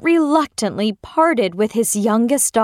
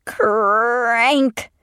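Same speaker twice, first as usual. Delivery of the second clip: door creak. Acted. Palace door creaks open.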